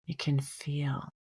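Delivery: gently guiding a breath meditation, unhurried; guided meditation. Acted. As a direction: ease the listener into calm breathing. Leads a meditation.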